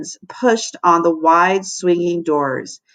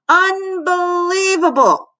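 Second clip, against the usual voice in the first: slow, much higher, outraged cry; outraged monitor; 9 semitones higher, slower than usual. A narrator's reading. Missus Buns catching Melvin on the table, outraged. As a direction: stretch it out in disbelief.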